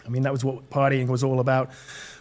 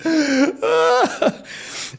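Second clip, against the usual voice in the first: high sharp gasp; a sudden overwhelmed gasp; retold gasp. Recalling feeling God as father, high sharp gasp. Retelling being overwhelmed by love.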